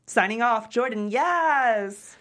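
slow, loud triumphant yes; drawn-out sign-off cheer. Acted. Jordan signing off his vlog, drawn out and proud.

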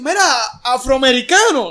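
overly correct, exaggerated politeness; mock polite greeting. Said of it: "si yo te veo en la calle te voy a decir".

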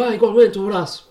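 high, cheery mock reply; playful imitation of Jorge answering; friendly reply.

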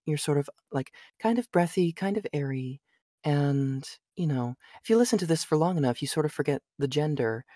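soft, breathy, airy female voice. Shown as a demonstration. Voice actor showing he can narrate as a woman, breathy female voice. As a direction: put it up light and airy, softly. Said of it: "I can do a female voice".

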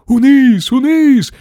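high, frantic repeated yell; old man's frantic squeal; screaming. A narrator's reading. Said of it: "верещав дідусь Джордж".

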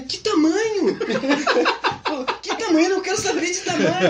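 high, loud, laughing indignant cry; refuse, outraged at the question; indignant outburst. Retold or imitated. Missionary refusing to try on a suit, high and indignant.

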